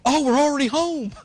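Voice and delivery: high-pitched, oblivious surprise; mock surprise. Preacher mimicking an absent-minded driver arriving home, mock surprise. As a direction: lift it high, as if just noticing.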